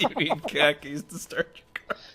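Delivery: laughing mock repeat. Real, not acted. Mocking a friend's claim.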